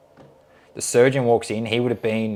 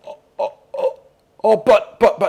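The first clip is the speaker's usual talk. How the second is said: halting, broken mock protest; flustered stammering; 13 semitones higher, 6 dB louder than usual. Nurse tries to answer the stern surgeon, flustered stammer. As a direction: stutter helplessly, jumping up high.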